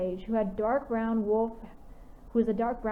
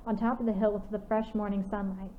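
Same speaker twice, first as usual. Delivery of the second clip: plain reading voice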